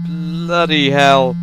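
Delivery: loud slow startled exclamation. Real, not acted. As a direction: blurt it out in shock. Jump scare.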